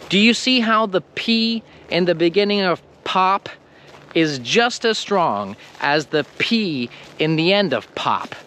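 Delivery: ordinary talk, nothing marked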